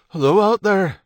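loud, high hail; calling out. A narrator's reading. Stephen on the microphone to workers outside, calling out. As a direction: call to whoever is outside.

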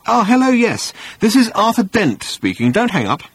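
high, excited answering voice; eager phone manner. Acted. Arthur answering an imaginary phone, eager.

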